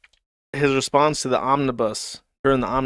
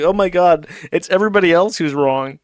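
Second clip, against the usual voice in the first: higher, louder mock exclamation; mock indignation; 5 semitones higher, 6 dB louder than usual. Commentator mocking Ricada in denial, mock-indignant. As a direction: put on a wronged, disbelieving protest.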